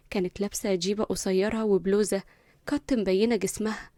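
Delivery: ordinary delivery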